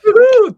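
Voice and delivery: loud, high celebratory woohoo; cheering whoop. Real, not acted. Cheering for guest's casting news.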